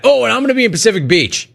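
high, rushed mock of a caller; mock a caller blurting a tangent; imitating rambling caller. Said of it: "you're like".

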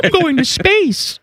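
high, slow cartoonish Tom Cruise voice; squeaky cartoon-character take on Tom Cruise; Tom Cruise impression. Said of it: "I'm gonna give you a little Tom Cruise interview".

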